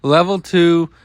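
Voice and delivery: usual speaking voice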